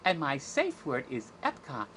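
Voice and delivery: put-on cartoon princess voice; sweetly mock a Disney princess; hammed-up storybook princess. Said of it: "That's the Disney version".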